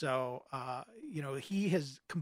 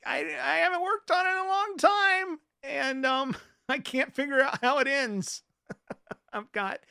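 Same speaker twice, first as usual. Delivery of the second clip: sheepish laugh; 9 semitones higher, 5 dB louder than usual. Real, not acted.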